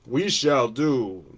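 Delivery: slow, stiff, mock-formal; mock pompous legalese. Union steward mocking contract legalese, slow and pompous. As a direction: ham up an old-fashioned decree. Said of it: "Shall is ambiguous".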